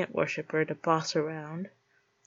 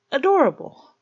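mock adorable; 8 semitones higher, 10 dB louder than usual.

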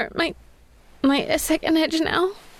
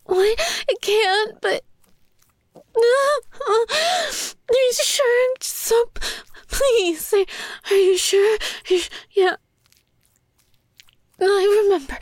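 startled protest; 7 semitones higher than usual. Acted. Told no by her partner, startled and pleading. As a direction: protest in shocked dismay.

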